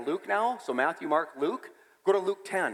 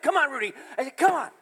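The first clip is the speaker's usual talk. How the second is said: excited coaxing; 8 semitones higher, 3 dB louder, faster than usual. Retold or imitated. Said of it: "So I'm like".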